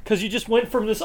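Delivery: normal talking voice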